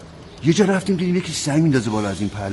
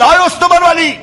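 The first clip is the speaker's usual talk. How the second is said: much higher and louder shout; shouted call; 8 dB louder, faster than usual. Acted. Officer shouting for his sergeant, loud call. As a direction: yell across the station for him. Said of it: "داد زد".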